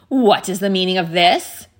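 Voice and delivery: loud, imperious demand; outraged witch queen. A narrator's reading. Witch confronts the frightened party.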